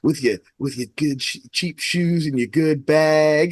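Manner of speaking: slow, lightly put-on Southern drawl as Hannibal; a hint of Hannibal's Southern drawl, mocking; slow mock Southern lilt, sneering at the shoes; Hannibal's little accent. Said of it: "he's doing a little".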